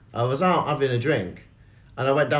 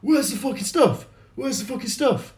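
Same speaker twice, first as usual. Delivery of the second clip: imitated angry man; 9 semitones higher than usual. Man heard raging in toilets.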